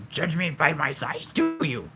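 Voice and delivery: high, odd Yoda voice. Shown as a demonstration. Doing Yoda for the viewers, in a high Yoda voice. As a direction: croak it out like little old Yoda. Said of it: "I'll try to do Yoda".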